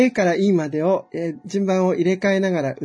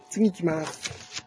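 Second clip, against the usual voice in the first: ordinary delivery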